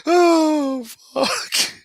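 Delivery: high squealing laugh, then breathless oh fuck; laughing too hard to speak; helpless laughter. Real, not acted. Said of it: "that's tickling me so much".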